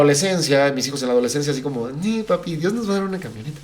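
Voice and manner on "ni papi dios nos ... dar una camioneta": higher, fast mock child voice; imitating the kids. Kids insisting to their father, higher, fast mock child voice. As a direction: play the hopeful kid pleading with dad.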